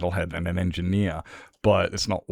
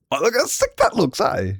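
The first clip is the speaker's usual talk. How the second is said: much higher, thrilled squeal of delight; excited; 10 semitones higher, 3 dB louder than usual. Real, not acted. Streamer showing chat his new flag, excited high gush.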